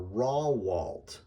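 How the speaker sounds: Rawalt drawn out as "RAW-walt"; mispronounced surname. Shown as a demonstration. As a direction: say Rawalt slowly with an open "raw" first syllable. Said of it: "because they're looking at raw".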